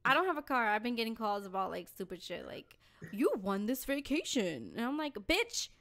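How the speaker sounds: normal talking voice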